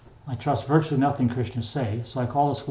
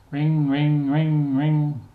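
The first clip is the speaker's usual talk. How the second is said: even, louder repeated rings; imitated phone ring; 3 semitones higher, 4 dB louder, much slower than usual. A narrator's reading. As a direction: sing out each ring on one held, raised note. Narrator imitates a phone ringing.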